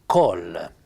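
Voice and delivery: col detto con la O chiusa piemontese; pronuncia piemontese. Shown as a demonstration. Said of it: "Allora vede mon". (pronuncia piemontese di col)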